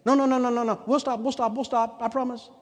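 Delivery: high pleading no no we'll stop; a small child begging to be spared. Retold or imitated. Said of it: "Youngest child began begging".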